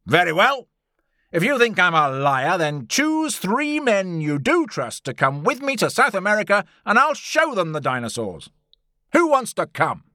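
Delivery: loud, high challenge to a hostile crowd; losing his temper. A narrator's reading. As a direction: throw it down angrily at the hecklers. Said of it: "started to lose his temper, and his voice became louder".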